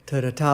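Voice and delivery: "ta-da-ta" with weight on the final TA; quick "ta-da" into a stressed "ta"; light-light-heavy. Shown as a demonstration. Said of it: "light, light, heavy".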